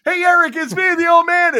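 high, loud holler through a door; acted-out doorstep call. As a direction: shout a greeting up to a friend's door. Calling at Eric's door.

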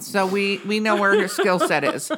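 ordinary talk, nothing marked

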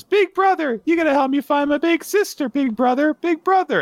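put-on loud, very high-pitched little girl voice. Little girl calling to her big brother, squeaky child voice. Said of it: "the little girl says".

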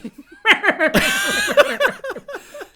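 loud, very high Jim Carrey-style cackle; mock a big, high, goofy laugh; imitated Lacey Evans laugh. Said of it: "the Jim Carrey laugh where she goes".

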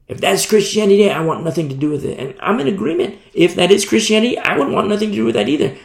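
high, loud, fast outburst; heated agreement. Real, not acted. Preacher siding with hurting kids against fake faith, high and loud. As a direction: fire up in indignant agreement.

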